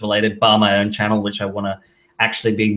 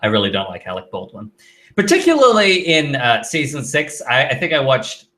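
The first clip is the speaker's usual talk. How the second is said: normal talking voice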